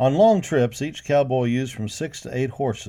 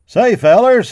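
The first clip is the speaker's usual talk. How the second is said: loud, high shout; 11 semitones higher, 13 dB louder, slower than usual. A narrator's reading. Cowboy hollering to his pals at the corral, loud shout. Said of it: "a cowboy shouted".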